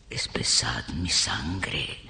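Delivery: very low, slow whisper; dark incantation. Acted. Invoking spirits to harden her. (conjuro susurrado y grave)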